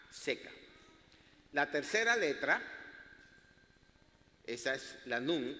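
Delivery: usual speaking voice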